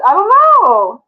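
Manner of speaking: squeaky mock whine. Pretending to cry over a missing friend, squeaky whine. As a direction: whimper it in a tiny put-on voice.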